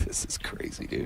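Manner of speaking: amused hushed whisper. Real, not acted. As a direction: whisper through a laugh. Whispering during putt.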